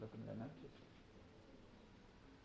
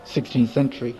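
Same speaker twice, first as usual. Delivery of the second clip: ordinary delivery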